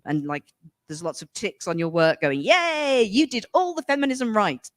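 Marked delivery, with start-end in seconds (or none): 2.4-4.7 mock cheer. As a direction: cheer it like a sarcastic teacher. Mocking imagined praise on homework.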